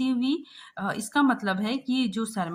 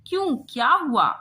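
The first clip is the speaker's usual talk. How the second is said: puzzled question; 6 semitones higher, 6 dB louder than usual. Acted. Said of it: "अपने चश्मे को पोंछते हुए कहा".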